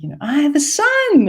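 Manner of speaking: high, falling proud announcement; high proud old lady. Retold or imitated. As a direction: announce it like a proud elderly mother. Woman's voice retold.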